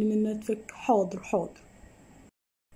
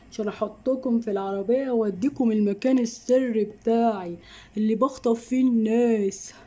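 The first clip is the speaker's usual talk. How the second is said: ordinary talk, nothing marked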